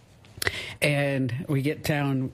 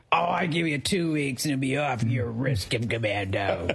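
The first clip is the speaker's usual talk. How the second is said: put-on old Louisiana man; 2 semitones lower than usual. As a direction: imitate your co-host as a crusty Louisiana old-timer. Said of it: "ninety-year-old guy from Louisiana".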